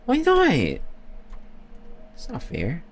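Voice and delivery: high then breathy, slow sulk; whiny complaint. Pretend. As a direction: sulk like a kid.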